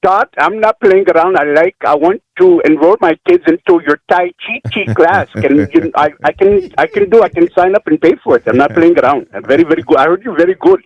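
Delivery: lightly put-on Indian accent; a hint of Indian accent. Shown as a demonstration.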